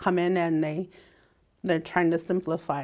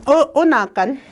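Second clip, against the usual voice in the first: Ojibwe accent; 6 semitones higher, 7 dB louder than usual. Shown as a demonstration. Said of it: "pull out my Indian accent".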